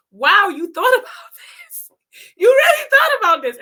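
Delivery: high, loud words through real laughter; can barely get it out for laughing; laughing hard. Real, not acted. Speaker cracking up at coworker's idea, laughing loud. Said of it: "I just started laughing and couldn't stop".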